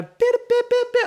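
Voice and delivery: very high, loud nonsense syllables; squeaky parody of Cork chatter; mock Cork accent. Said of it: "they've got this boop accent".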